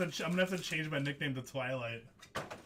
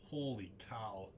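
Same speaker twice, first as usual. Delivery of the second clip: softer, lower murmured repeat; quiet; 7 semitones lower, 9 dB quieter than usual. Real, not acted. Breaker repeating his reaction to himself, hushed and low. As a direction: say it under your breath, in awe.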